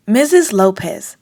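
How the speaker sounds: ordinary delivery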